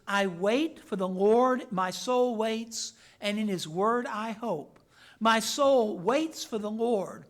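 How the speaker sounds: loud, measured psalm reading; solemn, emphatic scripture read. Acted. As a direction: proclaim the verses to the congregation. Reads psalm aloud in sermon.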